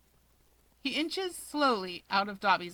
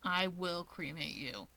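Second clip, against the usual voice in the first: lower, much softer and slow; flat low threat; 4 semitones lower, 9 dB quieter than usual. Acted. Dabi to Keigo, a deadpan quiet threat.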